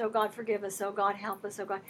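soft prayer. Retold or imitated. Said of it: "he starts out kind of soft".

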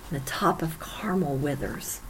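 sudden hushed whisper on the verse's end. Acted. Preacher reading to the congregation, fading to a whisper. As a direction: drop to a whisper as the land withers.

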